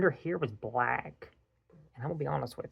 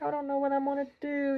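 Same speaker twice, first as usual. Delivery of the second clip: much higher, faster whiny wife voice; mock despairing wife; 13 semitones higher, faster than usual. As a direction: play the panicked wife for laughs. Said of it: "It's like".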